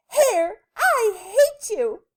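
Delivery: high, loud, drawn-out yell; furious storybook lady shout. A narrator's reading. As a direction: scream it at the hair. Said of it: "The lady yelled".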